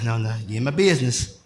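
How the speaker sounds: mock church member talking back to Pastor; congregation pushing back. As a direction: play the members saying mind your business. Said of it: "I see y'all looking like".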